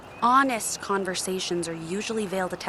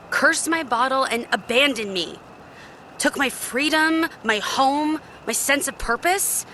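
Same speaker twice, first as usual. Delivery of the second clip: higher, louder bitter rising complaint; bitter outburst; 6 semitones higher, 4 dB louder than usual. Acted. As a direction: let the resentment spill over. Genie recounts master's betrayal.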